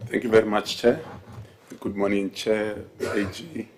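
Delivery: ordinary talk, nothing marked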